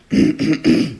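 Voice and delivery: deliberate rattling throat clear. A narrator's reading. Ogress preparing a false voice, throat clearing. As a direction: clear your throat to change voice. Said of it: "Logresse s'éclaircit la gorge".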